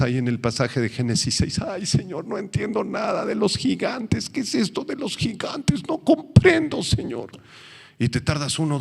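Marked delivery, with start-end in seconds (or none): 1.6-7.3 high, pleading mock whine; a believer lost in Genesis, whining high to God; confused believer. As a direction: whine to the Lord that you don't get it.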